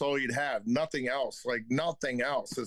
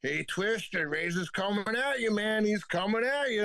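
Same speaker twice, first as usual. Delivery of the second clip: higher-pitched, lightly acted loudmouth trainer; 5 semitones higher than usual. Trainer warning Twister that Razor's coming, lightly imitated loudmouth. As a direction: imitate a trainer stirring things up. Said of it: "the loudmouth".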